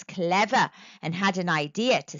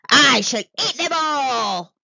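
loud, higher monster roar; 4 semitones higher, 8 dB louder than usual. A narrator's reading. Monster heading home with his catch, roaring. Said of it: "roared the monster".

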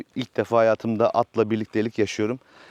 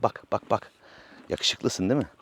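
soft coaxing; 4 semitones lower, 6 dB quieter than usual. Real, not acted. Cooing softly to the horse.

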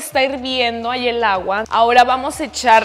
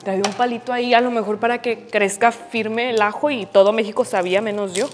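normal talking voice

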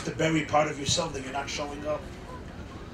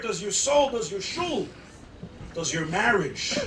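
preaching at full force; 5 semitones higher than usual. Acted. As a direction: build each question higher. Rising preaching list of questions.

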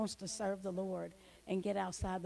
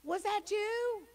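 high, slow, wondering call; calling out to the sea; 13 semitones higher, 4 dB louder, slower than usual. Retold or imitated. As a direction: call out over the water, amazed. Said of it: "And I was like".

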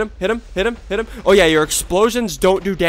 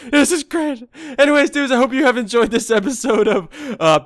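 ordinary talk, nothing marked

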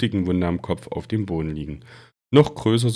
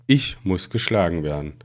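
ordinary delivery, nothing marked

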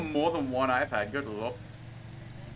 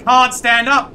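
much higher, louder mocking yell; 9 semitones higher, 14 dB louder, slower than usual. Real, not acted. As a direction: belt out the joke. Punchline yelled at friends.

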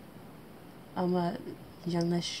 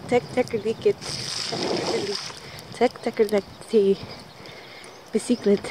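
excited; 5 semitones higher than usual. Real, not acted. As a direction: struggle excitedly for the word. Struggling to find the word, louder.

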